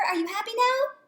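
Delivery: high, hopeful question; eager call. Acted. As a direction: ask it brightly and hopefully. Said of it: "he called".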